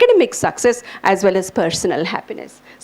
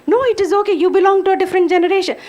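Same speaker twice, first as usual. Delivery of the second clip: put-on higher and quick, a teen brushing mom off; mock teen insisting; 8 semitones higher, much faster than usual. Son brushing off his mother's worry, quick and high. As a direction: rattle it off like a dismissive kid. Child answering mother, acted quarrel.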